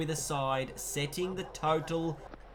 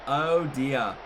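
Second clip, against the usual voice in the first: dismayed groan; slower than usual. Real, not acted. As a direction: let the disappointment ring out. Reacting to run out.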